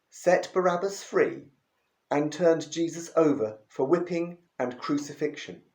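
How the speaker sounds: usual speaking voice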